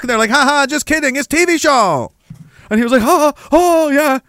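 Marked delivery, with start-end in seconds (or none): 0.3-2.1 high, hammed-up Chinese accent; joking cop in a caricatured Chinese accent; mock Chinese cop. Chinese cop reveals the prank, mock Chinese accent. 3.0-4.2 high, put-on nervous laugh; mock terrified. Terrified man answers prankster cops, hammed-up nervousness. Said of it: "He was terrified".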